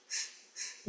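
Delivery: isolated puffs of f; blown f. Shown as a demonstration. Teacher isolates the word ending, blown f. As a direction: blow a short f through the lip and teeth. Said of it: "É só colocar um f".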